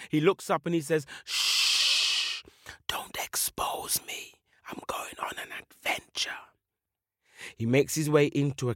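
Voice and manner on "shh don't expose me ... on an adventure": slow, secretive whisper; long shush, then hushed whispering; hushing whisper. Acted. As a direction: whisper it like someone afraid of being given away. Warrior hushes the birds.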